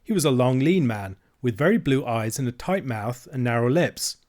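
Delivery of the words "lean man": ordinary delivery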